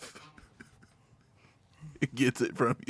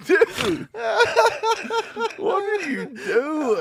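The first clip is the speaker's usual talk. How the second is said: laughing; 13 semitones higher, much slower than usual. Real, not acted. Co-host cracking up.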